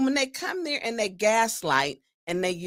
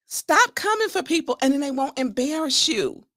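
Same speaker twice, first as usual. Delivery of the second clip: normal talking voice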